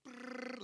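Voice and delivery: high trilled brrr; unrolling scroll sound. Shown as a demonstration. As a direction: trill it like a list rolling out.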